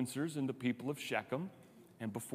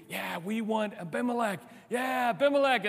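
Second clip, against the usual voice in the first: cheering crowd; 8 semitones higher than usual. Pretend.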